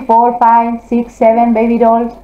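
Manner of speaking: normal talking voice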